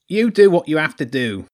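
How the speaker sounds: high, loud rat lord; Lord Ratzenberg. Acted. Rat lord leaves children to it, loud high character voice.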